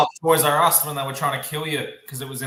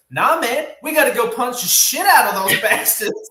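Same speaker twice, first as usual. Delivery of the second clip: higher, louder teasing impression; hot-headed friend; 9 semitones higher, 5 dB louder than usual. Teasing a friend about being the type to fight, mock hot-headed. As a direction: play the friend itching to punch, with a laugh. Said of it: "you're the type of guy who goes".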